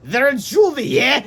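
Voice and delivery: high, loud and gloating; sinister smirk. Acted. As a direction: sneer it like a grinning villain. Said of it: "with a sinister smirk".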